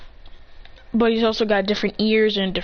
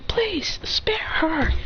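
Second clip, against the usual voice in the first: whispered plea; 7 semitones higher, much slower than usual. Retold or imitated. A boy begging his teammate to spare the girl, in a whisper.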